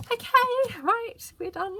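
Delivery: very high, loud sing-out of relief; triumphant relief. Real, not acted. Crafter telling viewers the ordeal is finished, triumphant relief.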